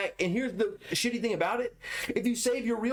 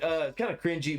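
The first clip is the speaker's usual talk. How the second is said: usual speaking voice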